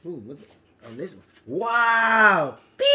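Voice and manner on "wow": higher, loud delighted wow; excited wow. Real, not acted. Reacting to picture for child.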